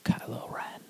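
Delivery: whispered, no voice. Acted. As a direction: hush it like a secret. Whispered spoiler.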